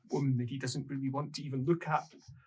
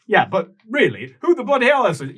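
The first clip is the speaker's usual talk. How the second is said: higher and louder, exasperated shout; angry outburst; 9 semitones higher, 10 dB louder than usual. Acted. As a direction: blow up at the doorman. Arthur losing patience with guard.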